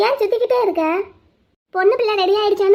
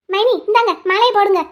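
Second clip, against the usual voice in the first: normal talking voice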